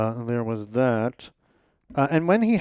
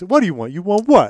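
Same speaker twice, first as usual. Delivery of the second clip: higher, louder, mocking taunt; taunty; 5 semitones higher, 6 dB louder than usual. Shown as a demonstration. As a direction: needle him with each word. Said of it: "it could be delivered in a very taunty".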